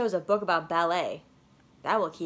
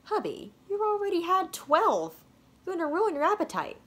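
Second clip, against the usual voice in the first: mom's voice; 10 semitones higher than usual. Acted. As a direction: gently scold like a mom. Mom voiced in story.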